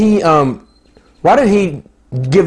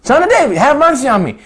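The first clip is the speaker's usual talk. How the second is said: crying out; 9 semitones higher than usual. Acted. Blind Bartimaeus calling to Jesus over the crowd, crying out. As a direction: cry out for mercy over the crowd.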